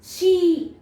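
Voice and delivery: शी with a held "ee"; long E demo. Shown as a demonstration. Teacher showing the open vowel, शी with long E. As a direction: stretch the vowel in शी. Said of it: "इसलिए long sound दे रहा है".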